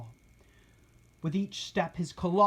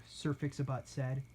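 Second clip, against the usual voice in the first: usual speaking voice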